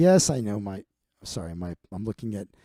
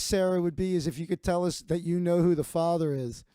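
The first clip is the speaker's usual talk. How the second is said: ordinary talk, nothing marked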